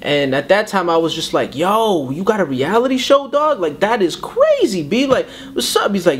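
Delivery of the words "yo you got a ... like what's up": high, stretched-out amazed hype; slow and amazed, like it's huge news; hyped up. Retold or imitated. Hyped reaction to reality show.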